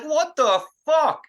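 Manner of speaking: yelling in shock at being slapped. Retold or imitated. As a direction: blurt it out in disbelief after the slap. Slapped hard, shocked reaction.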